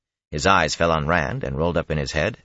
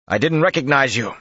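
raised, high-pitched shout; calling back; 9 semitones higher, 6 dB louder than usual. A narrator's reading. Rand answers the hidden men, calling out loudly. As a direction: holler the reply across a distance.

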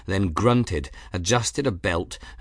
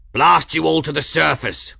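furious scream, higher and louder; 6 semitones higher, 7 dB louder than usual. A narrator's reading. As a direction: curse them at full volume. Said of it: "Journeyman screamed".

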